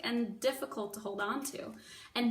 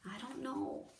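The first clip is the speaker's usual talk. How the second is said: much softer, trailing off; quiet; 8 dB quieter than usual. Acted. The reader softly repeats Mig's answer, quiet. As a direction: let it fade out.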